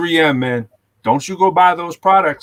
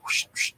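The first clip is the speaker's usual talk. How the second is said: unvoiced soft whooshes made with the mouth; whooshing noise; 10 dB quieter than usual. Man shows himself working on the HVAC, soft whooshes. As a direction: imitate a quick soft whoosh. Imitating opening the HVAC thing.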